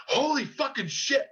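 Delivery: high shocked exclamation; shocked yell. Retold or imitated. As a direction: blurt it out in horror. Retelling shock at bone.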